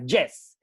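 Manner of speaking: "yes" with a buzzing Spanish Y, "jes". Shown as a demonstration. Speaker demonstrating the Spanish "yes" to listeners. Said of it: "Así, pronunciando la Y".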